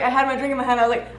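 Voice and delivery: ordinary delivery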